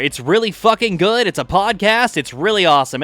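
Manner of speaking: loud booming show-intro announcer. Shown as a demonstration. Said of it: "may we hear announcer jinx?".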